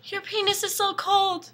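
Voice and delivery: high, put-on dramatic girl; mock girly voice. Girl confronts the vampire in the woods, high mock-earnest voice. Girl's dramatic confession, acted.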